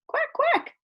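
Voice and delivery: very high, odd-toned quacks; mother duck quack. A narrator's reading. Mama duck calling to her babies, high quacks.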